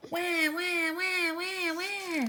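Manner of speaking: put-on high wailing baby cry. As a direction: wail like an unhappy baby. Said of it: "The baby cried even louder".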